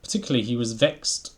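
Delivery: lively storybook reading voice; story narration. A narrator's reading. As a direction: read it like a bedtime story. Reading Beatrix Potter aloud.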